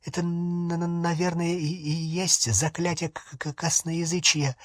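stammering babble. A narrator's reading. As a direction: stumble timidly over the consonants. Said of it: "а Гермиона пролепетала". (тихий лепет с заиканием)

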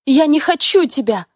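high loud desperate yell; hysterical shout. Acted. Heroine yells at husband.

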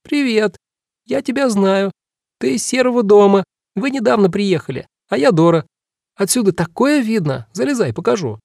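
put-on high squeaky little girl; squeaky girlish voice. A narrator's reading. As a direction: pitch up into a squeaky child's voice. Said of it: "кукла сказала писклявым девчоночьим голосом".

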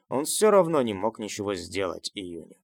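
ordinary delivery, nothing marked

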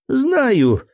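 second "знаю" jumps high in irritation; "знаю" repeated with a sharp high annoyed lift. A narrator's reading. Cousin Benedict brushes off the captain, annoyed. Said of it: "досадливо пожав плечами".

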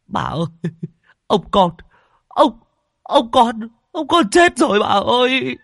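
very high, loud stammering cry; terrified servant. A narrator's reading. Servant tells mistress her husband died, terrified. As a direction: shaken servant blurting the death.

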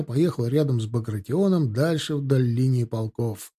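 usual speaking voice

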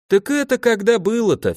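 high-pitched, offhand musing; philosophical. A narrator's reading. Alexey dodging spears, philosophically brushing off the accusation. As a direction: shrug it off in a lifted voice. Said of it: "философски заметил Алексей".

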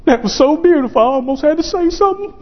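high-pitched imitation of an overcome man; moved tough guy. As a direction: voice climbing as he gushes. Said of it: "This big old burly, hardened, tough guy".

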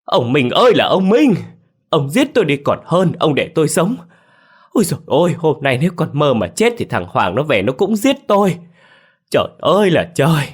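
high, loud anguished scream at grandpa; furious wailing. A narrator's reading. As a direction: wail at him in despair and rage. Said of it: "bà tôi tức giận gào lên". (gào lên giận dữ, cao và to)

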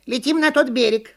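high monkey call; high-pitched cartoon shout. A narrator's reading. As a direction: cry out the order as an eager monkey. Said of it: "крикнула «Почешись»".

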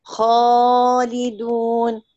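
خالدون slowly, with a full heavy alif after the kha. Shown as a demonstration. Teacher shows a heavy alif to her student. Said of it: "هتلاقي الألف هنا مفخمة".

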